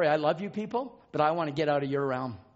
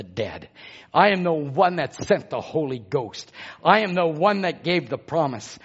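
voice of God; 7 dB louder, slower than usual. Retold or imitated. God speaks through Brother Branham's lips, building in authority. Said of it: "that voice from the other dimension".

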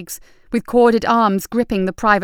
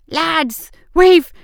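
loud yell mid-effort; straining shout; 8 semitones higher, 6 dB louder than usual. A narrator's reading. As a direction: strain out the words. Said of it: "urging them on".

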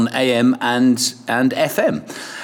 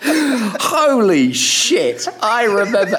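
laughing; slower than usual. Real, not acted. Recalling the rusty ship.

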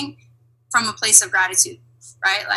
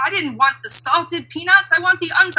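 higher-pitched petty passenger grumble; mock complaining; 8 semitones higher than usual. Comedian's airline passengers triggered about some peanuts, mock complaining. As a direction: imitate a fussy complainer. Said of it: "and they were complaining".

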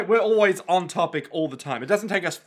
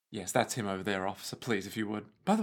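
hushed, low bystander voice; quiet bystander aside; 9 semitones lower, 10 dB quieter, faster than usual. Pretend. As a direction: murmur to the officer. Plays bystander to officer.

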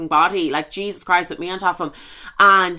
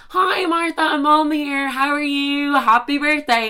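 high, drawn-out sing-song greeting; mum on the phone; 8 semitones higher, slower than usual. Retold or imitated. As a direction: voice a mum's birthday call, high and slow. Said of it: "Literally all I hear is".

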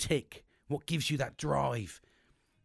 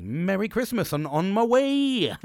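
drawn out loud and slow; loud slow farewell; 6 semitones higher, 8 dB louder, slower than usual. Retold or imitated. To his mate, a loud, slow, jokey Christmas farewell. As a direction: belt it out like a jolly toast.

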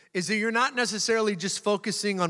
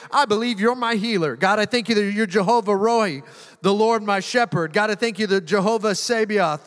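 louder, fervent praise prayer building in force; 5 dB louder than usual. Shown as a demonstration. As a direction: proclaim each name of God with fire. Prays names of God.

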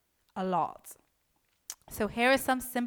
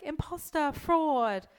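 lightly voiced inner critic, pitched higher; mock accusing brain; 7 semitones higher, 3 dB louder, slower than usual. Coach voicing the brain accusing its owner, mock accusing.

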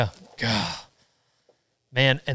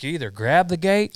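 louder, higher mock yell; retold yelling; 6 semitones higher, 5 dB louder than usual. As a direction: imitate a father yelling an order. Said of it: "my old man yelling at me".

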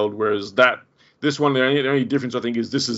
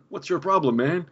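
higher, jumpy "what's your problem"; freaked-out retort; 6 semitones higher than usual. Retold or imitated. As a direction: imitate a spooked guy snapping back. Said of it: "He's like".

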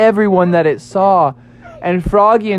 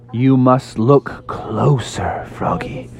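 low, very slow, hushed sage; deep, drawn-out, whispery counsel; wise mystic Toadstool; 4 semitones lower, much slower than usual. Acted. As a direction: draw it out slowly like a mysterious master. Toadstool gives mystic advice to frog.

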